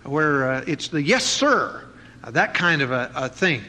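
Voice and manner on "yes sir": military yes sir. Retold or imitated. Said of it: "like, in the military".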